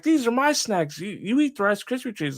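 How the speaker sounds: high-pitched mock protest; imitated snack squabbler. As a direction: argue back in her high voice. Retold squabble over snacks.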